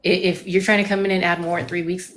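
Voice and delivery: ordinary delivery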